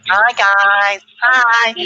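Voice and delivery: very high, loud camp greeting; Paul Mobs. Retold or imitated. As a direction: squeal it out high, drawn-out and camp. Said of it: "that's how he talked".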